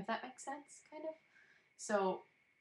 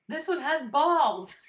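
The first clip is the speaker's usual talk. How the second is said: high, loud and slow, a punchline delivered big; drawn out loud and high for the whole room; doctor's blunt joke; 9 semitones higher, 12 dB louder, much slower than usual. Retold or imitated. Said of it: "the doctor said".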